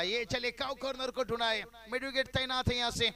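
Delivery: loud, high live boundary call; excited cricket commentary. Acted. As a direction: call the four with rising excitement.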